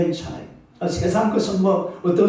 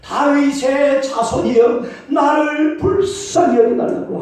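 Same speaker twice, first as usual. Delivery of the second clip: higher, slow pleading cry; louder cry; 7 semitones higher, 3 dB louder, slower than usual. Retold or imitated. Bartimaeus crying louder despite the crowd, slow pleading shout. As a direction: stretch every word, begging harder. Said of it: "더 크게 막 소리쳤어요".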